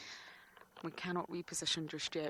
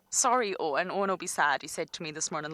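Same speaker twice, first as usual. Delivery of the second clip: usual speaking voice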